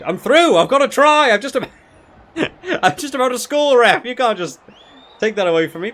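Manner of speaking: high, loud excited yells at the ref; excited shouting. Real, not acted. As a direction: shout out in delight, then protest. Playing rugby game, excited outburst.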